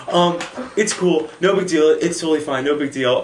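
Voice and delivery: high, loud, flustered reassurance; over-insist while acting all worked up; choked up, emotional. Rejected boy saves face before class, emotional. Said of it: "I start getting all emotional".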